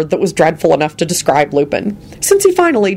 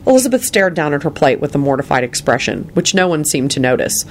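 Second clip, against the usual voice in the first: ordinary delivery, nothing marked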